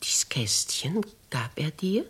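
whispered, rising question; an old lady suddenly taking the story seriously; startled whisper. Acted. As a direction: ask it under your breath in alarm.

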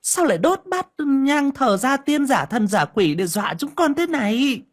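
sneering daughter-in-law, pitched high; sarcastic. A narrator's reading. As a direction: needle the mother-in-law with a high-pitched, theatrical complaint. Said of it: "ra giọng mỉa mai".

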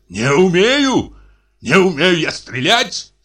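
high, drawn-out indignant cry; outraged cry. A narrator's reading. Insulted brother about his shooting, outraged cry. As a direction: stretch each phrase in offended disbelief. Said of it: "закричал Михей Егорыч так громко".

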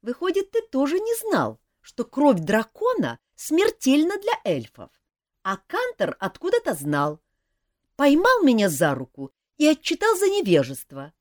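put-on young elf prince. A narrator's reading. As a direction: confess it seriously but eagerly. Принц объясняет Жаку. (молодой принц-эльф, серьёзно, выше и громче)

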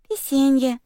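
put-on high, lisping little child cry; excited toddler shout. A narrator's reading. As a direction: burst out like a toddler. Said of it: "внезапно воскликнула Оливия".

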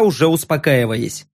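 usual speaking voice

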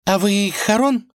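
high, thrilled question; excited girl. Acted. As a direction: ask with bright delight. Vasilisa voiced in story.

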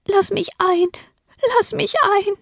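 put-on very high, wailing child-ghost plea; plaintive wail. A narrator's reading. Ghost child still begs at the broken window, wailing. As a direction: keep wailing to be let in, high and thin. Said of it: "Immer noch klagte es".

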